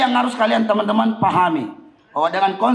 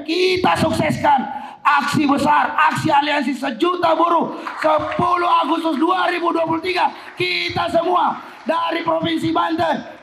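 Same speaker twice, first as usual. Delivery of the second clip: fiery revolutionary speech; 8 semitones higher than usual. Acted. Rally speech building to climax.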